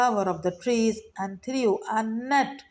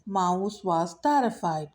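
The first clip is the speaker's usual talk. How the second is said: ordinary delivery, nothing marked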